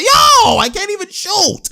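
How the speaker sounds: very high, squealed mock protest; Kawhi. Commentator voices a player held back from shooting, squealed protest. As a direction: yelp it way up high as a thwarted player. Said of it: "Kawhi's like".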